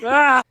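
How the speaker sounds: short, loud, high yelp; playful scream. Pretend. Friend teased about fleas on the bed, playful yelp. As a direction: let out a sudden playful scream. Yells amid laughter over fleas.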